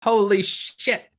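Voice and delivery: high, incredulous barkeep rant; astonished barkeep. Acted. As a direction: splutter in disbelief at the customers. Voices barkeep shocked by money.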